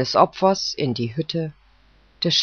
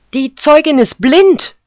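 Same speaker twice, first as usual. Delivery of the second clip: much higher and louder outcry; cry out; 11 semitones higher, 9 dB louder than usual. Acted. Poodle objects loudly that the witness is blind. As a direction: call it out in protest. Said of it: "rief der Pudel".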